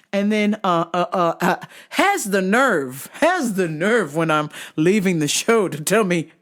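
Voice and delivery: ordinary talk, nothing marked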